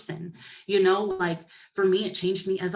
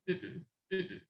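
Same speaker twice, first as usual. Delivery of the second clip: mean inner voice; 11 dB quieter than usual. Retold or imitated. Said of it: "that's what my mean voice is named".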